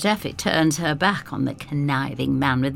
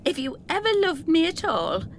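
higher, earnest plea; pleading girl; 11 semitones higher than usual. Acted. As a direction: appeal to his love.

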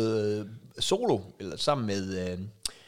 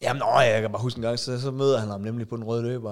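ordinary talk

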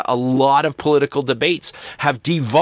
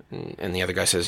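usual speaking voice

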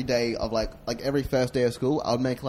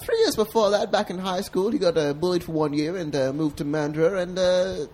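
high-pitched persona telling his story; chatty high character voice; high alter ego voice; 5 semitones higher, 5 dB louder than usual. Pretend. Alter ego narrates [name]'s life.

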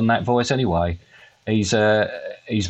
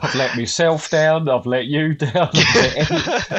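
hammed-up lament, pitched up, laughter creeping in; mock despair, higher and laughing; mock-contrite apology; 5 semitones higher than usual. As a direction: overplay the apology until you crack up. Mock grovelling apology to listeners.

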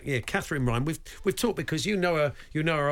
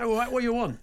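put-on cockney accent; 9 semitones higher, faster than usual. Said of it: "he laid it on a bit thick".

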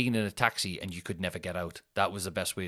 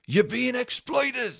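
mock worker warning; 5 dB louder, much slower than usual. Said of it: "some of the factory workers were like".

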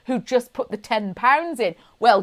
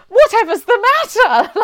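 baffled surprise; 10 semitones higher, 8 dB louder than usual. Retold or imitated. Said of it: "So Mum's like".

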